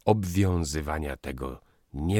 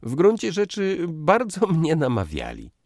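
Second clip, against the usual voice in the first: high, loud, taunting delivery; exaggerated, spiteful lightness; venomous teasing; 14 semitones higher, 7 dB louder than usual. A narrator's reading. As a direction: rub it in with a raised voice. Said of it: "uśmiechnął się jadowicie".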